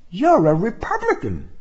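a hint of a young woman; woman's voice. A narrator's reading. Woman's retort.

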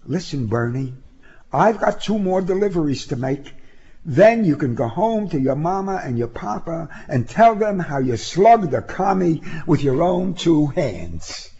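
lightly voiced insistent young activist. Woman character's dialogue.

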